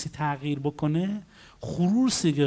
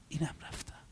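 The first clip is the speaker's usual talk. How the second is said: whispered, self-satisfied brush-off; smug dismissal; 2 semitones lower, 19 dB quieter than usual. Acted. Client turning down the counselor's solution, smugly hushed. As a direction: drop to a proud little whisper. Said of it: "با یه غروریا".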